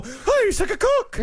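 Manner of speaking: high silly shout. Retold or imitated. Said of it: "like Daniel Day-Lewis for Lincoln".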